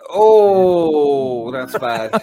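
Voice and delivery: loud high oh of mock dismay; a loud, pained oh at the jab; mock shock. Real, not acted. Co-host reacting loudly to a jab.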